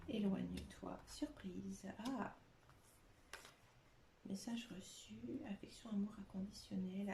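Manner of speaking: hushed, barely voiced listing of the cards; muttered whisper. Real, not acted. Reading her tarot cards half to herself, in a soft whisper.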